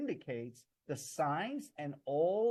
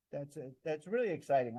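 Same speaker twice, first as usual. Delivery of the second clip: normal talking voice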